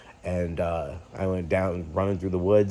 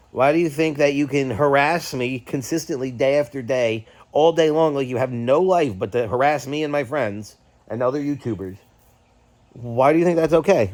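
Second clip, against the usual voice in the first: raised, higher, louder demanding questions; angry confrontation; 6 semitones higher, 4 dB louder than usual. Retold or imitated. Vlogger confronting his troll, loud and angry. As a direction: fire accusations at the harasser, voice up.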